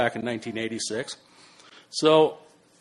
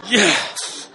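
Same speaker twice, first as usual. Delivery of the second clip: relieved yes; 7 semitones higher, 5 dB louder than usual. Retold or imitated. Relief when sheriff passes him.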